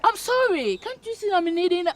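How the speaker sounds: high, loud pleading; desperate apology. Acted. Kneeling before an angry partner, desperate apology. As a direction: beg urgently to be forgiven.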